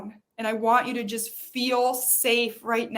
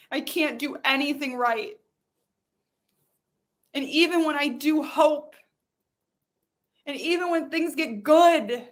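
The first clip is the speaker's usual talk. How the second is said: anguished venting; 3 semitones higher than usual. Shown as a demonstration. Venting aloud in emotional release exercise.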